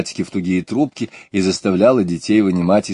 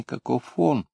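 ordinary delivery, nothing marked

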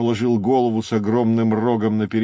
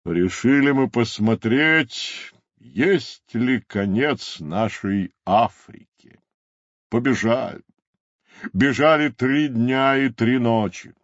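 emphatic character voice, building louder and higher; storytelling rhinoceros; 5 semitones higher, 3 dB louder than usual. Acted. Rhinoceros tells of running across Africa, building theatrical voice. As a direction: tell it grandly, rising toward the ocean.

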